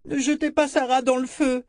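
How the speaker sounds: put-on very high, pleading little-girl voice; imploring child. A narrator's reading. Frightened girl begging strangers to spare her doll, imploring. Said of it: "implora-t-elle".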